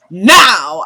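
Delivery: loud retold yell of now; shouted command. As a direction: bark it like ordering her out. Mother throws Jennifer out.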